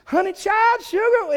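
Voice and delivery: hammed-up Southern drawl, pitched high and loud; big Southern drawl. Preacher imitating friend on the phone, hammed-up Southern drawl. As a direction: mimic a Southern belle's exaggerated drawl. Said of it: "this big southern hick drawl thing".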